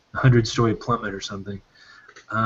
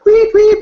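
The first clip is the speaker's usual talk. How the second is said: loud, very high whistle sound; shrill it out like a gold whistle; imitated whistle blasts; 9 dB louder than usual. Exciseman blows his whistle on arrival, loud high weep. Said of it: "He comes running up".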